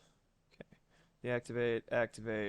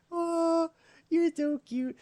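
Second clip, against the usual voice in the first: cooing; 6 dB louder, slower than usual. Real, not acted. Streamer cooing at her cat in baby talk. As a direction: squeal it up high at the kitten.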